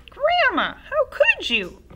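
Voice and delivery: put-on high, loud disgusted boy; appalled boy. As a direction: recoil in disgust.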